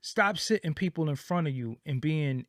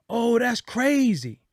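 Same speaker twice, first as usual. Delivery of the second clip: higher, slow, drawn-out gushing; mock awe; 7 semitones higher, 4 dB louder, slower than usual. Host mocking listeners who swallow wild claims, slow and high. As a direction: stretch it out like a dazzled fan.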